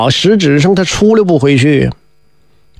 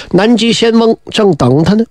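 plain reading voice

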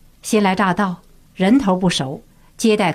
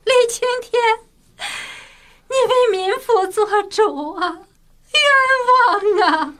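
shrill wailing plea; 13 semitones higher than usual. A narrator's reading. Kneeling woman begs the official for justice, wailing shrilly. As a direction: cry out for justice in anguish, very high. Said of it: "凄厉地高声哭叫道".

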